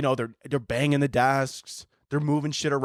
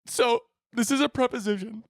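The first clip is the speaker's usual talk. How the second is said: high, hammed-up weepy voice; teaching through tears; 9 semitones higher than usual. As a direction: keep the lesson going while crying. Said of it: "I would just start crying up there".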